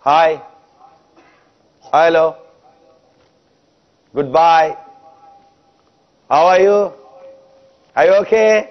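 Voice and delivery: mock casual greetings. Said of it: "اب تقریباً عموماً یہ ہوتا ہے".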